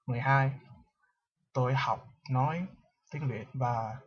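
careful, hesitant Vietnamese tones; halting learner's Vietnamese. Shown as a demonstration. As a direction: speak Vietnamese like a shaky learner. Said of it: "attempt to speak Vietnamese right now".

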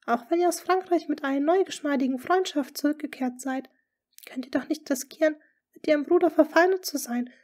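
high, loud, urgent lady's voice; anxious court lady. A narrator's reading. Lady Rochford warning the duke, high and urgent.

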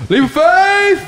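excited shout. Real, not acted. Jumping off cliff, shouting.

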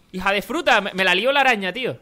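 normal talking voice

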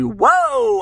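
loud, high drawn-out whoa; big exaggerated startled cry; mock astonishment. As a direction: act out a cameraman taken aback. Father startled by overweight uncle onscreen.

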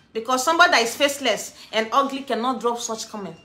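usual speaking voice

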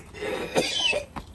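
retching cough at the smell; gag cough. Real, not acted. Gagging cough as the rotten fish reeks. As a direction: choke and gag on the stink.

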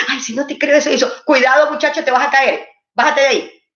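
mock motherly warning shout; alarmed mother's warning. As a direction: break off to yell a warning at a child. Mother breaks off chat to warn child.

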